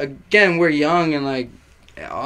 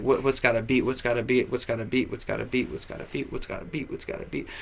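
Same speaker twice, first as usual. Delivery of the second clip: low mutter fading to a whisper; muttered thinking; 4 semitones lower, 9 dB quieter than usual. Retold or imitated. Guy searching his head for a song, muttering to himself. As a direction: repeat it under your breath, fading away.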